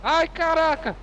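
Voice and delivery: high startled yelp; shocked cry. Real, not acted. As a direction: cry out at the crash. Crash in game.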